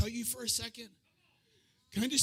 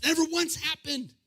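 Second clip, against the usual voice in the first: high-pitched imitated disbelief; baffled protest; 5 semitones higher than usual. Disciples searching their memory before Jesus, high baffled protest. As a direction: lift the pitch in mock bafflement.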